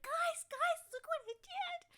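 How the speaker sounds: very high, loud excited screech; screeching goblin. Acted. Goblin screeching to the party about his spell. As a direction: shriek it out in triumph.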